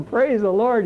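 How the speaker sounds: high, bright cry of praise the Lord; excited yell. Retold or imitated. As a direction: cheer it out high like the crowd. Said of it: "they were yelling".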